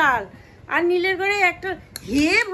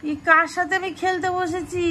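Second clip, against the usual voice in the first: ordinary talk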